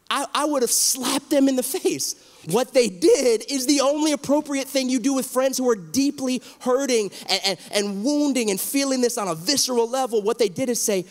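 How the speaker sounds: fired-up preaching. Real, not acted. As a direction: preach it with rising passion. Impassioned pastor defending friends' empathy.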